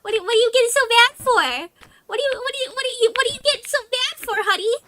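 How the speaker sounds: high-pitched, fast-talking Jersey mom; Jersey mom accent. Acted. Streamer playing defensive to chat, Jersey mom accent. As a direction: do an exasperated New Jersey mom. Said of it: "Love the Jersey mom accent".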